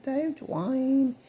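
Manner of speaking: put-on high, bright little Southern accent greeting; playful Southern accent impression of a TV character; Whitley Gilbert. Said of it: "Whitley Gilbert talks like a little Southern accent".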